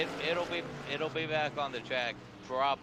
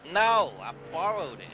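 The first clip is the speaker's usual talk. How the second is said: indignant, higher-pitched denial; raised-voice comeback to an accusation; loud protest; 8 semitones higher, 8 dB louder than usual. Real, not acted. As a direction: protest loudly at being called a thief. Protests joking accusation of stealing.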